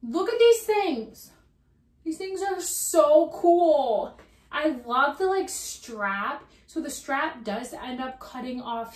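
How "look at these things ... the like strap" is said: high, loud, drawn-out gushing; excited admiration. Real, not acted. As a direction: stretch it out, loud and thrilled. Admiring the clogs on her feet.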